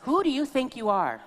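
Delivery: mother's challenge repeated; mock scolding mother. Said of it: "one of them would look at me and goes".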